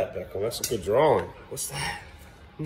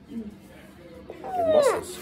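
very high, very slow drawn-out muscles; mock amazed squeal; admiring coo; 4 dB quieter, much slower than usual. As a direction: stretch the word out in awe. Praising toddler's muscles.

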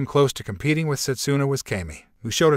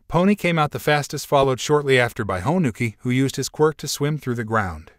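usual speaking voice

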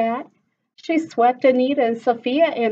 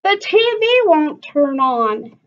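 higher, louder whining complaint; whiny cry; 7 semitones higher, 6 dB louder than usual. Acted. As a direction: cry out at the dead TV. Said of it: "Anita cried".

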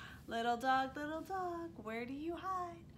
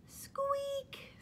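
very high, thin squeak; high-pitched mouse squeak; tiny mouse squeak. Acted. Mouse sound in story.

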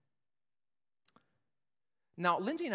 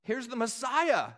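ordinary talk

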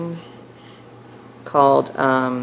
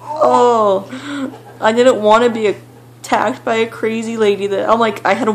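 still scared; 7 semitones higher than usual. Real, not acted. Vlogger reliving the scare for viewers, shaken and high. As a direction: let the fright come back into the voice. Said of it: "I was so scared".

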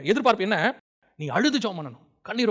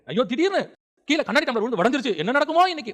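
higher-pitched imitation of anxious fretting; mock worry; 7 semitones higher, 4 dB louder than usual. Preacher mimics people fretting over a broken glass, mock worry. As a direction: fret aloud like a superstitious worrier, lightly exaggerated.

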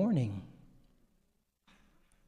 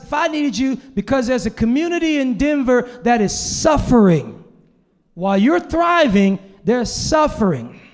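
God's challenge; 6 semitones higher, 7 dB louder than usual. Retold or imitated. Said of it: "And God said".

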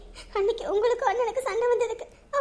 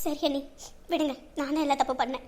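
softer, lower, defeated; hurt resignation; 6 semitones lower, 6 dB quieter than usual. Acted. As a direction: give in with wounded sarcasm. Gives up the quarrel. (மனம் உடைந்த அமைதி)

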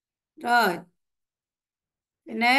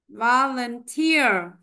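Volunteer said very slowly, final syllable stressed; stress demo; 3 dB louder, much slower than usual. Shown as a demonstration. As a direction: drag Volunteer out to show the stress. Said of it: "cô đọc và các bạn theo dõi". (đọc thật chậm Volunteer, nhấn âm cuối)

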